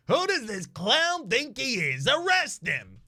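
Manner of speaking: high, loud, indignant yell; outraged talking necktie. Acted. Necktie egging a cop to arrest, outraged yell. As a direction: demand it at full, high-pitched force.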